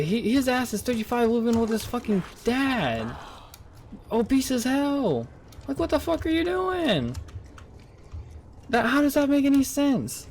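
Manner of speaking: incredulous. Real, not acted. About the coworker living with his dad, exasperated high disbelief. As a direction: rant at him in high, baffled disbelief. Mocking coworker Big Boy Jim.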